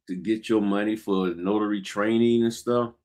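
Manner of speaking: normal talking voice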